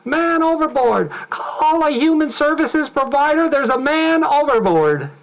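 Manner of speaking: high, loud, urgent cry for help; desperate high yell of a fellow passenger; panicked yell. Retold or imitated. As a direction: shout it across the deck in alarm. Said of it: "hear one of your fellow passengers yell".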